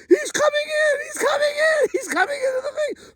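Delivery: very high, crying shout of panic; imitating a friend screaming in fear; terrified cry. Friend terrified, pushing door.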